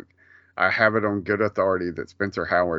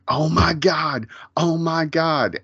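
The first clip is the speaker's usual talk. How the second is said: mock excited gasp; 8 semitones higher, slower than usual. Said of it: "were like".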